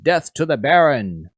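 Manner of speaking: loud, high, defiant battle cry; rebel's yell. Acted. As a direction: yell it out like a rebel fighting off guards. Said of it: "he's yelling out".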